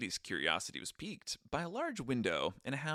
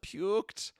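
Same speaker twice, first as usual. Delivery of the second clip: high, put-on proud friend voice; imitating Johannes; 10 semitones higher than usual. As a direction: show off the relic, voice pitched up. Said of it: "He's like".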